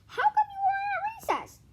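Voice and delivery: put-on very high child's question; squeaky classmate asking; kid voice. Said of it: "asked Jason".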